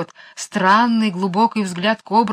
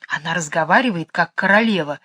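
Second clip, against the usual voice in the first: plain reading voice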